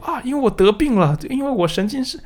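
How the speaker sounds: high-pitched mock alarm; 假装惊慌. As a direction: act out someone fretting they must be sick, voice way up. 扮演怀疑自己生病的人. (夸张地假装慌张，音调拔高)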